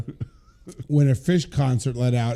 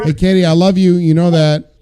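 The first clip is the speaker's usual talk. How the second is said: calling out; 3 semitones higher, 9 dB louder than usual. Real, not acted. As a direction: call out fondly to her. Calling out to caller.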